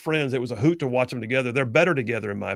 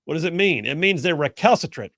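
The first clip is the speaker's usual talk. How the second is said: usual speaking voice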